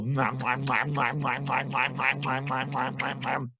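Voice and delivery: fast, steady munching "mwah"s; gobbling noises. A narrator's reading. Storyteller imitating dogs eating off tables, rapid munching. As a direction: gobble noisily without a break. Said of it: "they ate food right off the table".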